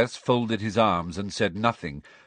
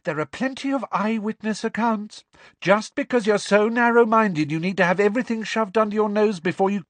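high, louder, rushing indignation; heated Luna; 14 semitones higher, 6 dB louder than usual. A narrator's reading. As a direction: speed up as she gets worked up.

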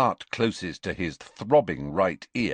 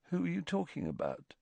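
soft, quick, higher-pitched question; puzzled murmur; 7 semitones higher, 11 dB quieter, faster than usual. A narrator's reading. Ron has no idea whom Harry means, quietly puzzled. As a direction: ask it quietly, genuinely lost.

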